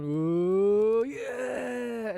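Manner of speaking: long loud rising cheer; crowd cheer. Retold or imitated. Fans at Anfield cheering a shot, loud and rising. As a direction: cheer like the whole stand. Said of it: "everybody going".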